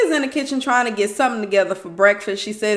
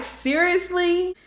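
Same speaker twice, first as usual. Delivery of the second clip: slow, higher, drawn-out seriously; exasperated; 8 semitones higher, slower than usual. Retold or imitated. Reacting to Mark insisting the baby comes, exasperated. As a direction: stretch it out in disbelief.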